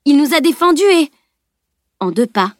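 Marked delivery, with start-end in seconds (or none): 0.0-1.1 high, loud, urgent protest. A narrator's reading. Camille protesting to Edwin, loud and urgent. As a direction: cut off mid-protest. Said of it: "protesta-t-elle".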